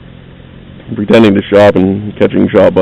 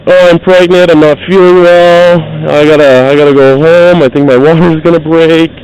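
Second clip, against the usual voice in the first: higher, mock-pleading shoplifter; whiny excuses; 6 semitones higher than usual. Woman caught shoplifting pleads with the guard, high and mock-whiny. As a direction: ham up her fake pregnancy excuses. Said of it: "she starts spouting off shit".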